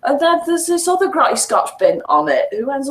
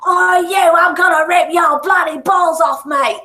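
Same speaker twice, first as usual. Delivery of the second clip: higher, louder hammed-up Cockney heckler; mock Cockney threat; 8 semitones higher, 3 dB louder than usual. Player mimics game's Cockney women threatening him, mock Cockney. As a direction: imitate a rowdy Cockney threatening someone.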